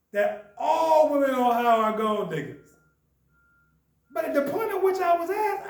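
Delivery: ordinary talk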